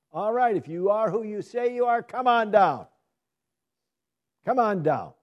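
loud, high jeering dare; mocking taunt. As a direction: goad him off the cross. Voicing the mocking crowd.

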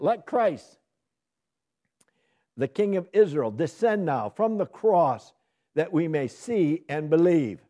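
loud, slow jeering challenge; taunting mockers. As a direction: dare him to come down.

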